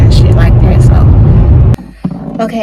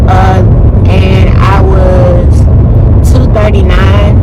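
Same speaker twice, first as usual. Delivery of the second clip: ordinary talk, nothing marked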